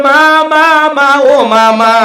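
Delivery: put-on a child's repeated call for mother. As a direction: pester the mother with calls. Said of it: "মারে যদি ডাক দেন".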